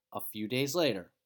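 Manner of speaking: ordinary delivery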